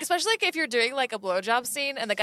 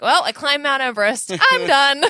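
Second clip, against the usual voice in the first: mock pissed-off declaration, getting louder; playfully exasperated retelling, higher at the end; retold annoyance; 3 semitones higher, 6 dB louder than usual. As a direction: build to a loud, fed-up finish, half joking. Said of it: "And I was pissed".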